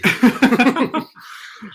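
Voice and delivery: shrieking burst of laughter; loud high laugh. Real, not acted. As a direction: cackle loudly at the joke. Big laugh after joke.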